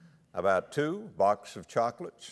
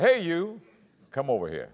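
mock gruff voice; 7 semitones higher than usual. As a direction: bark the call, then drop it. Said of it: "I put on a big, gruff voice".

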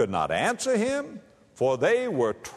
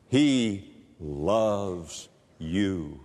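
tender; 8 semitones lower, much slower than usual. Real, not acted. Preacher reassuring a hurting listener, slow and tender. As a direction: tell them gently, slowly. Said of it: "tender assurance to congregation".

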